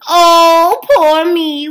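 loud, high self-pitying wail. A narrator's reading. Jamie moaning to himself about starving, loud high wail. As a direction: wail like a helpless lazy man. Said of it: "wailed Jamie".

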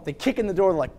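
ordinary talk, nothing marked

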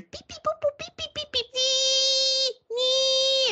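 very high detector beeps, rising to long loud squeals; high-pitched beep-boop mimicry; imitated electronic beeps. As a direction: mimic a gadget beeping, then whining "nee". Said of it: "all I hear is".

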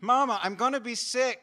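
put-on high, loud, insistent child's cry to Mama; carsick child. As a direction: wail it to your mother from the back seat. Sick child calls again, louder.

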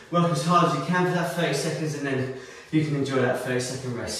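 normal talking voice

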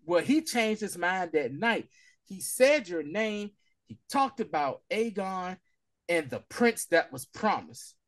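slow, measured reveal of a secret; slow, solemn telling; slow grave reveal. Retold or imitated. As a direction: drop it slowly, word by word. Slow revelation.

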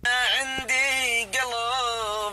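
high, loud, slow mock lament; mock love song to a friend; hammed-up lover. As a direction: plead like a wounded lover.

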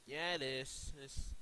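ordinary delivery

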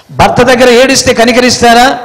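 ordinary talk, nothing marked

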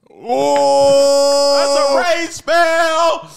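high, loud, drawn-out exclamation; yelled reaction. Real, not acted. Streamer reacting to a rage spell meme, yelled. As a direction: holler it, stretching the words out.

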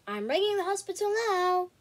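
a lightly voiced Becky announcing. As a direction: declare it like a bossy sister. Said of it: "announced Becky".

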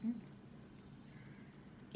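talking with mouth full; muffled mid-chew speech; chewing food. Real, not acted. Seen 1: Lips closed, cheeks moving while eating noodles; talks clearer by 2.0s.

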